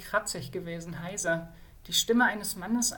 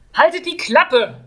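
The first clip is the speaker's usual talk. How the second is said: much louder, higher, slow snap; snapping; 9 semitones higher, 16 dB louder than usual. Acted. Kjeld cutting off the whining mage, snapping. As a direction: bite his head off. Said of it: "fuhr ihn Kjeld an".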